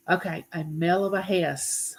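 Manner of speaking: coy spoonerism "a mell of a hess" for a swear; deliberate spoonerism. Shown as a demonstration. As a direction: swap the sounds on purpose in "a mell of a hess". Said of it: "I won't pronounce it the way it's supposed to be pronounced".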